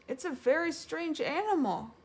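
high-pitched report of a strange sight; mild cry. A narrator's reading. Piglet spotting an animal outside, mild cry. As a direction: exclaim it in a small high voice. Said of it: "cried Piglet".

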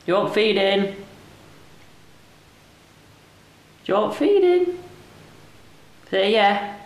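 high-pitched, coaxing questions; pet talk. Real, not acted. Owner urges the cat to say yeah, coaxing in pet talk. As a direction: coax an answer out of a pet.

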